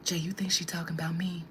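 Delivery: quick, hushed whispered question; fast whisper. Retold or imitated. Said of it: "she was like".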